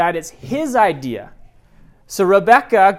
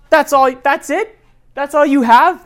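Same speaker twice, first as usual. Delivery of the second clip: higher, much louder mocking disbelief; incredulous scoff; 8 semitones higher, 7 dB louder than usual. Husband mocking his wife's insult, loud incredulous scoff. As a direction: scoff at how weak the jab is.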